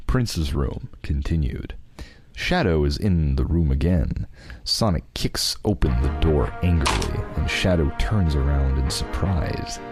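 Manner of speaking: usual speaking voice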